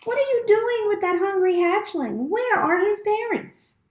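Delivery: put-on high old goose voice, suspicious and scolding; an old goose squawking at the robot. A narrator's reading. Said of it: "squawking to the robot".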